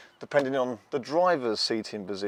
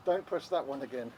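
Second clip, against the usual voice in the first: usual speaking voice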